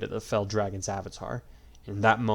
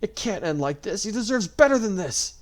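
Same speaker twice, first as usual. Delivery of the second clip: tearful protest; 11 semitones higher, 6 dB louder than usual. A narrator's reading. Crying girl protests.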